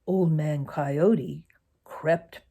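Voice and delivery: ordinary delivery, nothing marked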